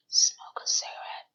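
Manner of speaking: unvoiced whisper, echoing the words; whispered repeat. Vlogger mocks Carrie's excuse in a whisper. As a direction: breathe the words out in a mocking whisper. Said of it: "To smoke a cigarette".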